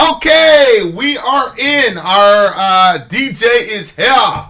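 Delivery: high loud show opener; hype announcer. Acted. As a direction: kick off the show big.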